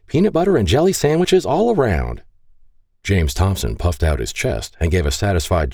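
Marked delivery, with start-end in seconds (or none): 0.1-2.2 high, gleeful crowing. Acted. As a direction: crow it out happily. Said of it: "she crowed".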